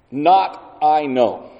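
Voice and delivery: "Not I know" very slow, NOT stressed and falling; literal word order. Shown as a demonstration. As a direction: spell out the literal Greek "Not I know" slowly. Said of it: "how they would be translated".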